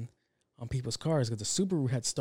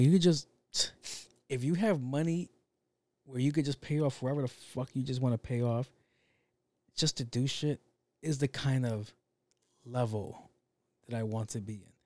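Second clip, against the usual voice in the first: normal talking voice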